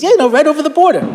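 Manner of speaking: quick and eager, pitch way up; eager insistence. Retold or imitated. Exchange student insists to host that Canada is Israel, eager and fast. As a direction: blurt it out brightly like it's obvious.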